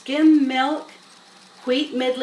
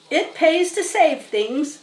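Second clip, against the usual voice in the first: slow, higher surly rat; 6 semitones higher, 4 dB louder, slower than usual. Acted. Rat boasting to the animals in a surly voice. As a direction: drag it out grudgingly. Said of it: "he said in his surly voice".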